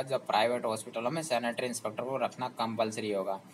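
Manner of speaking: ordinary talk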